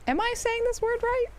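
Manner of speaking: high, unsure inner question; self-doubting. Retold or imitated. Streamer acting out her own doubt: high uncertain voice. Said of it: "I'm sort of like".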